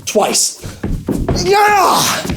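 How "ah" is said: very high, drawn-out kick cry; hammed-up second karate kiai; high martial-arts shriek; karate yell. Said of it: "but I know judo karate".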